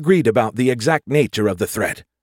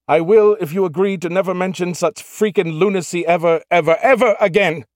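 higher, louder, insistent whine; shrill insistence; 9 semitones higher, 4 dB louder than usual. A narrator's reading. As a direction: repeat ever, ever like a tantrum. Skippy shouting from hiding.

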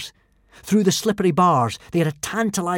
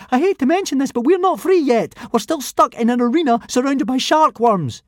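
put-on nervous boy voice; 9 semitones higher, 4 dB louder than usual. A narrator's reading. Fishlegs voiced, worried.

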